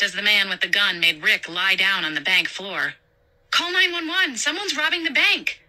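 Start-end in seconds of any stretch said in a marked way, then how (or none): 3.5-5.6 put-on high, urgent, frightened girl; a young clerk phoning in a panic; panicked young woman. Nina voiced in panic.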